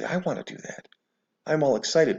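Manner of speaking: imitating eager followers in a high, soft voice; mock excitement. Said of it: "they get all excited".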